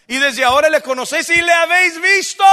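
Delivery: high, emphatic pulpit voice; full-force preaching. Real, not acted. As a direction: build loudly to "visto".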